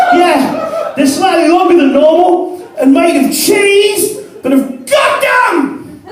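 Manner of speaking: high-pitched, giddy drugged wonder; tripping amazement. Acted. Man on acid to his housemate on finding his legs, giddy amazement.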